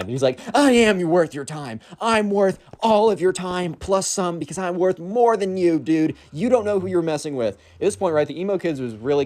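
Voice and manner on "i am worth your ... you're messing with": higher, slower, whiny boasting; mock insistent emo kid. Emo kid boasting at Hank, high and petulant. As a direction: insist on being worth it, petulant teen.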